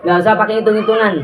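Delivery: ordinary delivery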